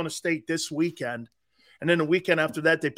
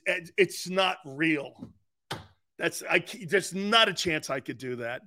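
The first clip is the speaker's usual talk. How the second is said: normal talking voice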